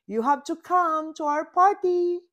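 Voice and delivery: high, fast eager invite; eager invitation. A narrator's reading. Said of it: "says Unicorn".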